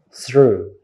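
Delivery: "through" ending on long oo; correct "through". Shown as a demonstration. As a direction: say "through" with th, r and oo sounds. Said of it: "but it should be".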